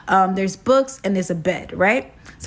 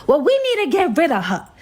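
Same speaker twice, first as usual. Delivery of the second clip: raised, higher mock yell at her man; shouting to get rid of the intruder; retold scream; 7 semitones higher than usual. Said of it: "all I hear is her scream out".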